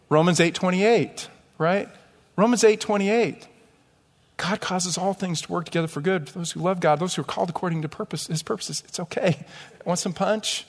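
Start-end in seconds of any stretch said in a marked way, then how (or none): 4.4-10.6 soft, hurried verse-quoting; hushed, uneasy attempt to soothe; awkward consoling. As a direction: mumble comfort quietly to a crying person. Acts out awkward comforter quoting verse.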